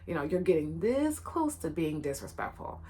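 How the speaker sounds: a low, measured, lightly voiced teacher's rebuke; teacher's stern warning. Teacher warns the playful student, a hint of stern teacher. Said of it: "But he said".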